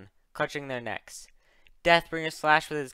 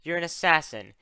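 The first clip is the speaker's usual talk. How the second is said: raised, forceful accusation; snapping; 4 dB louder than usual. Acted. Luna accuses the assassin of killing without pity, snapping. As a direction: hit each accusation hard, easing on the last question. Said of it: "Luna snapped".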